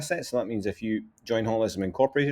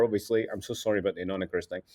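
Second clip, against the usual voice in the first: usual speaking voice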